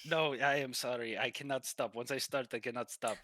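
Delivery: insistent Russian masseur; Russian-accented masseur. Acted. Masseur refuses to stop the painful massage, insistent Russian accent. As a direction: apologise firmly in a Russian accent. Game master plays the masseur.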